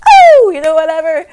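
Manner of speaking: high loud dismissive burst; loud outburst. Real, not acted. Telling viewers who did it, a loud dismissive burst. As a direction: blurt it out, brushing it off.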